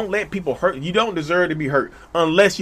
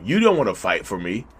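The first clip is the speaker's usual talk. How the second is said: mock narcissist; 7 semitones lower than usual. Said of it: "a narcissistic person will tell you".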